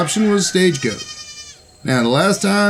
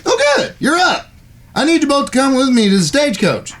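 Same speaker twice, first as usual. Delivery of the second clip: pleased as punch, pitched well up; eager cowboy brother; 8 semitones higher than usual. Acted. Brother hurrying his siblings to the stagecoach, high and eager. Character voice, higher than narration.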